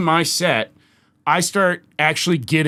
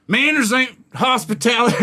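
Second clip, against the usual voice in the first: higher, lightly mocking woman's retort; drunk heckler retort; 8 semitones higher than usual. Comic quotes rude audience member, mocking higher voice. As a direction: throw her comeback back in a higher voice. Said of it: "she goes, she goes".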